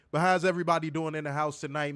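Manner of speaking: ordinary delivery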